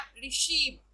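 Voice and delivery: Receive with the stress on सीव; stress on last syllable. Shown as a demonstration. Teacher showing students last-syllable stress, receive stressed on सीव. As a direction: lean on the long ई in receive. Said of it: "ई पे stress डाला".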